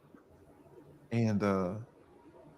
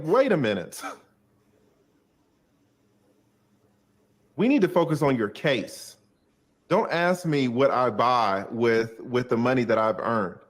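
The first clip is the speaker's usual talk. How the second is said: heated pushback; 3 semitones higher, 4 dB louder than usual. Retold or imitated. Lawyer snapping back at questioning client, heated. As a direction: put him in his place.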